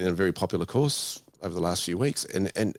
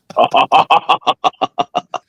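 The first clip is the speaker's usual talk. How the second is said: loud incredulous laugh; burst of laughter; 5 semitones higher, 13 dB louder than usual. Real, not acted. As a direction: explode laughing at the absurd offer. Absurd bank offer.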